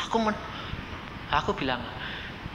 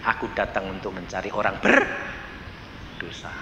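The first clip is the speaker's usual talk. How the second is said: low, soft quoted words of Jesus; a hint of the Lord speaking gently; lightly voiced Jesus; 10 semitones lower, 5 dB quieter than usual. Preacher quoting Jesus to the congregation, gentle low voice.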